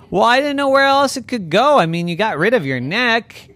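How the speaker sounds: loud, high excuse; defensive god. Acted. Zeus excusing the misplaced head, defensive.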